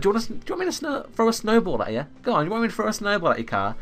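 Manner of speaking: high, fast parody of a driver's threat; mocking driver. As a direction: squeak out the silly snowball threat. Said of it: "he's like".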